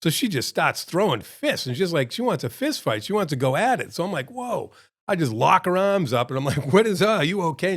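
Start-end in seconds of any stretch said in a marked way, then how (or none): 4.3-4.7 mock startled reaction. Reacting to old lady throwing punches. 6.7-7.7 retold startled question. Retelling startled question to attacking woman.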